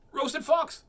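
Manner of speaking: high, loud yelp; startled exclamation; alarmed fox. Acted. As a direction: cry out in storybook alarm.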